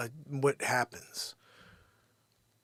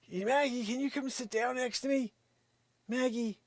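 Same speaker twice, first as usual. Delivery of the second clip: squeaky, much higher sweet talk to the dog; high baby voice for the dog; 11 semitones higher than usual. Acted. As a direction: coo at the dog like she's a baby.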